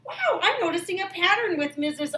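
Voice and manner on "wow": normal talking voice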